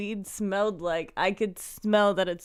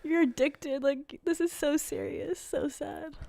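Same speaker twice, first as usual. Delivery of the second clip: tearful lecture; 8 semitones higher, 4 dB quieter than usual. Retold or imitated. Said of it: "I'd be like".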